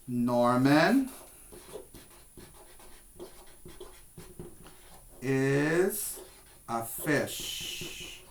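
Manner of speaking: put-on slow, fading words for the children to copy; slow modelling. Shown as a demonstration. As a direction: model it slowly, trailing off. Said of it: "What kind of pet?".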